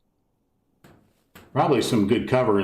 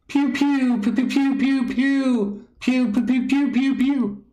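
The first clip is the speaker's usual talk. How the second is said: mouth gun noises; slower than usual. Pretend. Mimics gunfire at church pews.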